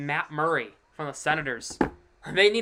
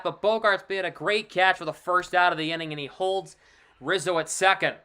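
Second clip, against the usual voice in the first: ordinary talk, nothing marked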